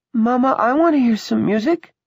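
put-on high, loud child's voice; little child. Pammy asking her mother for music, childlike. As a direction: pipe up like a child wanting a treat.

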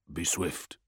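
soft, low mental voice; quiet urgent thought; whispery telepathic voice. A narrator's reading. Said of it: "he sent".

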